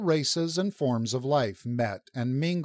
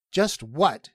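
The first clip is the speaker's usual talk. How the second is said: higher, louder icy challenge; 4 semitones higher, 5 dB louder than usual. A narrator's reading. Superintendent challenges Rin's release attempt, icily. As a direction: punch it with cold indignation. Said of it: "he added icily".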